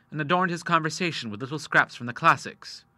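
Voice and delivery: ordinary delivery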